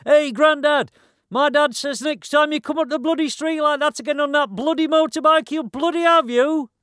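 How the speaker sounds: very high, loud yell; young lad shouting. Acted. Young git shouting at Grandad about the bike, angry yell. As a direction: yell it high and hard at an old man. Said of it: "comes running out of the passage shouting".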